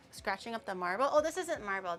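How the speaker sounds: normal talking voice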